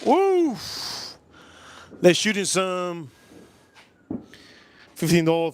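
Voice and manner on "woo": high, sharp "woo"; high whoop. Real, not acted. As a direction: let out a high-pitched whoop. Startled by fireworks on stream.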